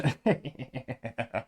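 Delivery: soft laugh; quiet chuckle to himself; amused chuckle. Real, not acted. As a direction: laugh softly at the absurdity. Laughs at quote he retells.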